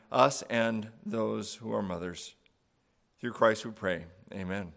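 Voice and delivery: hushed, reverent prayer voice. Acted. Pastor opening the Mother's Day service with prayer, hushed and reverent. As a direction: pray softly over the congregation.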